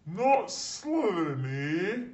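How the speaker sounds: put-on the Sorting Hat questioning the boy's wish; Sorting Hat, amused. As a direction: throw the boy's plea back, intrigued. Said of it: "said the small voice".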